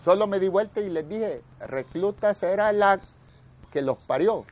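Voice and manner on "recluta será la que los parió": higher, slow, defiant comeback; mild mock yell of an insult; angry retort shouted. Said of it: "Así les grité". (réplica enojada, gritada a medias)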